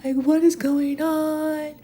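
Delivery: high, wailing distressed voice; mock crying lament. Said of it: "start crying like crazy".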